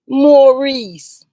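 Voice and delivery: scornful name-calling. Acted. Vlogger scornfully calling out Maurice's name, loud. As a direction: spit his name out in disgust.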